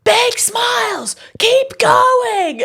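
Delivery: very high, drawn-out shriek; imitating yelling boss. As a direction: screech stage directions at a child. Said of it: "she was yelling at a kid".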